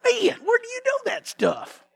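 awed onlookers, laughing and shouting out; amazed crowd. Retold or imitated. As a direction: gush over the new genius. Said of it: "everybody's going".

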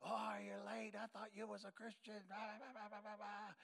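soft, jeering imitation of classmates; mock heckling. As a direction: tease like kids harassing a latecomer. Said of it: "everybody was harassing you".

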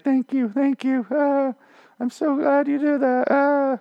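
put-on high, slow, breathy-free girly gush; hammed-up swooning thanks; mock grateful Laura. Laura thanking John for saving her, mock gushing.

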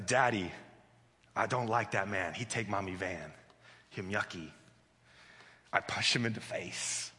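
low, soft imitation of a brooding toddler; stewing little girl. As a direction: sulk it out low, as a child nursing a grudge. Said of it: "The more she stews on it".

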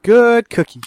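high, loud good-dog praise; praising a pet. Pretend. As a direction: praise it like a puppy. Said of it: "That's my catchphrase now".

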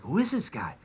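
hushed, quick gossip among guests; gossiping whisper. Acted. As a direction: lean in and murmur it. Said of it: "they're probably all whispering".

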